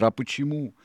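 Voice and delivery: high, loud scolding tirade; Silver, exasperated. A narrator's reading. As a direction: hammer the lesson into the crew.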